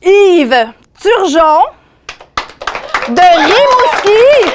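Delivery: slow, high, loud winner reveal; hyped giveaway announcement. Real, not acted. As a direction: draw out the winner's name. Announcing the draw winner live.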